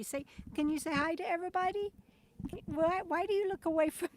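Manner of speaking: quick, high-pitched pet talk; coaxing a pet. Real, not acted. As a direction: coax a shy dog sweetly and quickly. Coaxing her dog to greet viewers.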